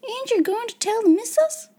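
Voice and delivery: high, worried little maid; high-pitched pleading question; anxious gasp. A narrator's reading. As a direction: ask in dread, pitch rising. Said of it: "she gasped".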